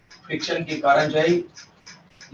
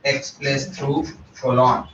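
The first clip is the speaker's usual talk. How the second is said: slow dictation; 3 semitones lower, much slower than usual. Shown as a demonstration. Teacher stresses key fact for notes.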